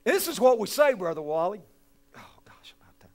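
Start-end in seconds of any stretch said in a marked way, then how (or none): 2.2-3.1 unvoiced whisper aside. Real, not acted. Preacher whispers an aside to Brother Wally, whispered. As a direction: breathe it out under your breath.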